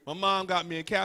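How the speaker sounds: ordinary talk, nothing marked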